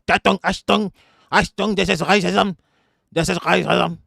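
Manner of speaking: high-pitched caricature German accent, harsh mimicry; mock German accent. Streamer mocking a Nazi crying racism, in a put-on German accent. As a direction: bark it like a hammed-up German Nazi. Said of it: "to do a German accent".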